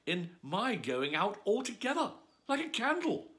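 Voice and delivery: put-on small girl fretting aloud; Alice's voice. Alice worrying to herself.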